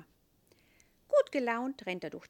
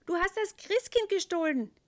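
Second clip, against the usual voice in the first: groaning angel; 11 semitones higher, 8 dB louder than usual. A narrator's reading. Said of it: "ächzt Elias".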